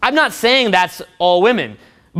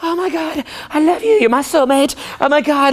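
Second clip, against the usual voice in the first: high, gushing movie-romance voice; hammed-up tearful adoration; mock reunited lovers; 7 semitones higher than usual. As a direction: gush with overwrought love. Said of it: "they're like".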